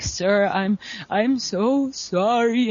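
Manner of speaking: slow, embarrassed sorry; sheepish apology. Retold or imitated. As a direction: drag out a mortified apology. Apologising to her idol.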